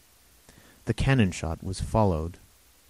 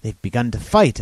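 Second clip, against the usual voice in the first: louder, higher urgent call; cried out; 5 semitones higher, 9 dB louder than usual. Acted. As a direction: cry out in alarm. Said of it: "I cried".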